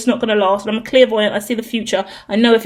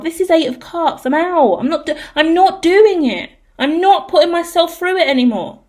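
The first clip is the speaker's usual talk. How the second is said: worked up, higher-pitched venting; fed up and emotional, higher voice; 4 semitones higher than usual. Real, not acted. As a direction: build into an emotional refusal, pitch raised. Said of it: "making me a little bit emotional now".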